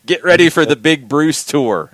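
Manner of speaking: big hype announcer call; booming tour announcement. Retold or imitated. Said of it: "is what Shooter comes in saying".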